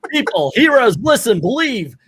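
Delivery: high, mock-heroic appeal to a crowd; imitated yelling.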